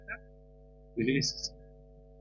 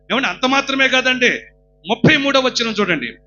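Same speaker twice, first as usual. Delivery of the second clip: loud, high preaching cry; fervent sermon shout; full-force preaching; 7 semitones higher, 17 dB louder than usual. Acted. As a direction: exhort the crowd with all your voice. Preacher reads verse loudly.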